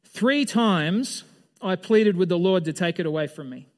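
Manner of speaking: scripture reading. Shown as a demonstration. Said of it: "let's read verse eight".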